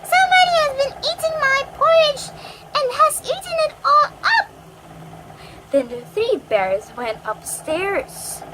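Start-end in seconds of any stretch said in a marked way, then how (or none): 0.0-4.5 very high, loud, quick squeak; tiny squeaky baby voice at full volume; squeaky baby bear. A narrator's reading. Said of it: "squeaky voice".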